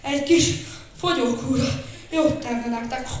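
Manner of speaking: groaning. Acted. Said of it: "nyögte".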